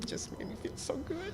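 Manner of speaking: soft moved whisper. Real, not acted. Telling the congregation how good it felt, in a moved whisper. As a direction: breathe it out, touched. Moved recalling the woman's thanks.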